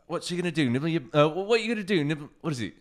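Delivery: quoting Monty Python in a mock British accent; British accent quote. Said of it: "my terrible British accent, too. So, like, I'm glad you did it".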